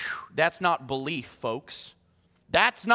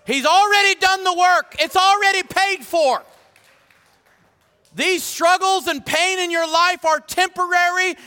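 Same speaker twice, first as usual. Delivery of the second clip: high, loud full-force preaching; impassioned shouted sermon; 10 semitones higher, 6 dB louder than usual. Acted. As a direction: proclaim it to the whole congregation.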